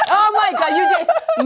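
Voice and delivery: high, laughing amazement; delighted laughter. Real, not acted.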